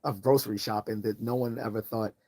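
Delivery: ordinary talk, nothing marked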